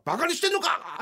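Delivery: high, loud imitation of a raging producer; furious producer. Producer scolding the comedians in the hallway, imitated fury. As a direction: tear into them like an angry boss. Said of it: "って切り出したの".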